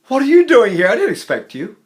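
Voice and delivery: high, loud burst of delighted surprise; happy surprise. Shown as a demonstration. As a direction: exclaim as if someone turned up unexpectedly. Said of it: "the happy surprise is one of the old".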